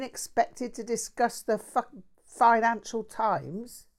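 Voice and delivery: a mouthy London bloke bragging; lightly voiced cheeky Cockney; Cockney mate. Jack's exasperated rant.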